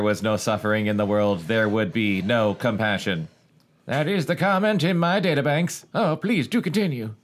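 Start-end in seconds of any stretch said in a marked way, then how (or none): 3.9-7.1 robot voice. Acted. Robot character answering narrator.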